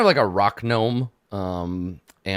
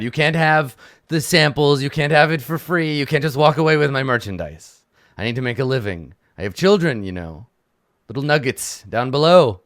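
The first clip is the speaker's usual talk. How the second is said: louder, firm pleading gnome; a touch louder, firm merchant pleading; insistent shopkeeper; 4 semitones higher, 7 dB louder than usual. Acted. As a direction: plead loudly to keep your stock.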